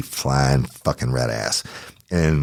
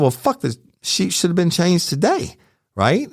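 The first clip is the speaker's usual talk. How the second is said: angry retort; 12 semitones higher than usual. Retold or imitated. Angry quote to hotel lady.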